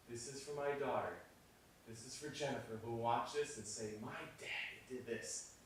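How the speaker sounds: quiet, heartfelt aside during a take; hushed dedication. Acted. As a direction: savour the moment under your breath. Murmured dedication while cameras roll.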